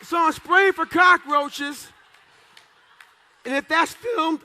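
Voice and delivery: the speaker builds suspense for the crowd in a slow loud shout; slow dramatic shout. Real, not acted. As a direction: drag each word out loud for suspense. Building the story's climax.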